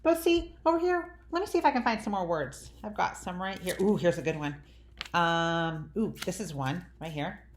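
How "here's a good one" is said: ordinary talk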